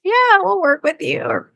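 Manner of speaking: high, slow, indulgent yes; drawn-out, sing-song obliging tone; gracious agreement. Retold or imitated. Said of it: "she graciously was like".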